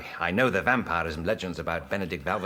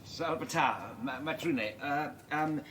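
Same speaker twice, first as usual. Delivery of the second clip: hesitant, higher, softer stammer; fumbling greeting; 7 semitones higher, 5 dB quieter, much slower than usual. Acted. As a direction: grope for the foreign words. Visitor fumbles greeting in foreign tongue.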